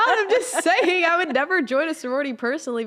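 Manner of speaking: loud protest. Real, not acted. Daughter defends herself after mother's teasing, loud protest. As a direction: protest loudly over the teasing. Teased for calling people out.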